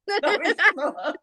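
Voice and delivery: laughing protest, high. Real, not acted. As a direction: protest through giggles.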